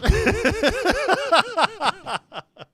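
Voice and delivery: shrieking laugh; loud high laugh. Real, not acted. Laughing at voice changer.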